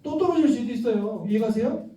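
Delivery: ordinary delivery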